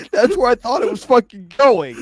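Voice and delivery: high, loud words through laughing; helpless laughter. Real, not acted. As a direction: squeal it out while cracking up. Can't stop laughing at joke.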